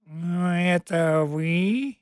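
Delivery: slow purring catlike drawl; purring cat voice. Acted. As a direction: purr it like a sly cat. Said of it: "мурлыкнувший".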